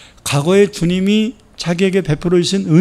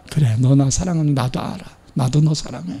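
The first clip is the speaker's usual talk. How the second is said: tender reassurance; 2 semitones lower, 5 dB quieter than usual. Acted. Jesus reassuring Peter, tender and soft. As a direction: say it gently.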